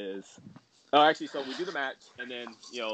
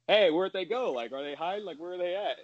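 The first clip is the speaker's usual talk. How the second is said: higher-pitched imitation of mock puzzlement; sarcastic wondering; 7 semitones higher than usual. Wrestler asks the crowd where his rivals went, imitated sarcasm. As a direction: play at searching for them, lightly sarcastic. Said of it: "being sarcastic, like, talking to the crowd".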